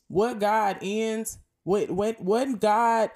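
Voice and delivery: ordinary talk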